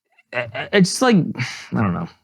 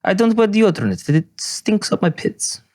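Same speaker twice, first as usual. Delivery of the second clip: a hint of a French accent, higher; mock French accent; 7 semitones higher than usual. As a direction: keep the cheesy French character going, higher. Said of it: "you want me to go French".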